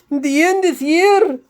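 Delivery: high, loud squealing fish voice; screaming fish. A narrator's reading. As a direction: shriek it like a panicked talking fish. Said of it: "started screaming in Yiddish".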